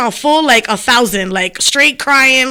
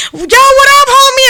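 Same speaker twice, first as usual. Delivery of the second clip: high, loud street greeting; hyped-up greeting; 11 semitones higher, 5 dB louder than usual. Retold or imitated. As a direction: call out to the homies, pumped. Greeting friends from the car.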